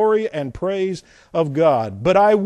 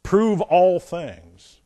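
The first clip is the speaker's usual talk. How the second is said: slow verse reading; 3 dB louder, much slower than usual. Real, not acted.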